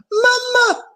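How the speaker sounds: very high frightened cry. A narrator's reading. Abu thrashing in the moat, crying for mama. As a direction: wail for his mother.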